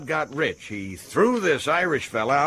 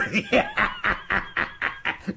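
long, high breathy cackle; high wheezing laughter; gloating laugh; 3 dB quieter than usual. Acted. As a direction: cackle with glee at your news.

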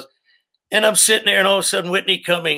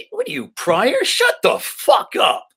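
retold angry snap; slower than usual. Retold backstage fight with Saget.

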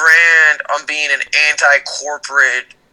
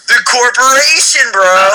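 high, pleased punchline delivery; triumphant punchline; 8 semitones higher, 3 dB louder than usual. Real, not acted. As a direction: land the punchline with glee.

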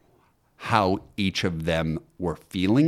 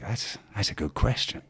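rough attempt at an Australian accent, softer; 6 dB quieter than usual. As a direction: quietly imitate an Australian, badly. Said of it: "I do a horrible, uh, Australian accent".

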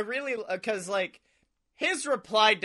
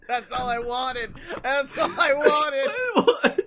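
helpless laughter through the words; cracking up; 7 semitones higher, 3 dB louder, slower than usual. Real, not acted. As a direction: build from laughing speech to a high, loud, stretched squeal. Cracking up while retelling reply.

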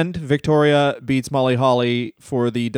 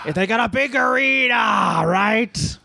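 high mock shout; 6 semitones higher than usual.

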